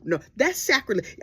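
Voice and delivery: normal talking voice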